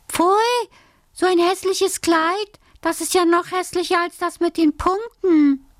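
put-on high-pitched indignant girl; angry girl's cry. A narrator's reading. Alice sees her painted dress, cries out crossly in a high voice. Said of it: "wurde sie sehr böse und schrie".

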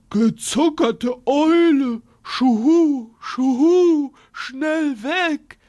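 hooting owl in a mild panic; panicked owl. Acted. Owl fleeing the monster, mildly panicked hoots. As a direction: flap off hooting in alarm. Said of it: "rief die Eule".

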